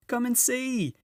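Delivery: high, loud joyful calling; a beaver shouting the good news; excited shout. A narrator's reading. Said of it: "almost dancing with delight".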